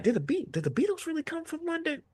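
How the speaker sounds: high breathless mock wonder; mock naive question. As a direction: ask it like a wide-eyed child. Mocking his own naive question.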